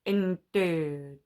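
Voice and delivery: low, drawn-out bad impression; Snape. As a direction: do a poor, low impression of a dour teacher. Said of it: "Snape was like".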